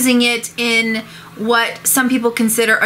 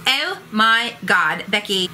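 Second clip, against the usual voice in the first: put-on valley girl gossip. Shown as a demonstration. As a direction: gossip to your friend Becky. Demo series of OMG variants.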